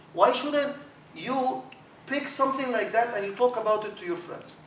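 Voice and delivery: ordinary talk, nothing marked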